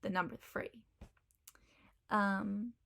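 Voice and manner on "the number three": "three" said as "free"; soft "number three" with F for TH; Cockney th-fronting. Shown as a demonstration. Teacher giving the Cockney number, "three" as "free". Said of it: "it would be".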